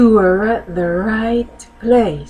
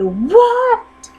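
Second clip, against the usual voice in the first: angry shout. Acted. Enraged priest shouts.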